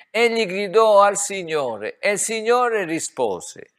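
loud, high, ringing proclamation; fervent preacher's cry; preaching shout. Real, not acted. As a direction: proclaim it at full voice. Preacher calling out scripture.

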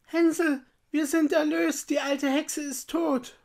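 put-on high, excited little-girl voice; joyful calling out. A narrator's reading. Gretel freeing Hänsel, calling out excitedly. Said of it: "öffnete sein Ställchen und rief".

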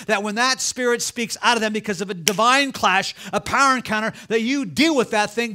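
loud, driving preacher's cry; full-force preaching. Real, not acted. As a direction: preach it hard to the congregation.

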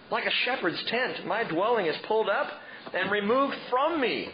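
hammed-up moaning prayer; tantrum lament. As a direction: wail like a sulking king. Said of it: "he was throwing a tantrum".

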